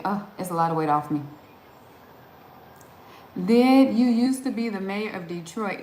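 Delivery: normal talking voice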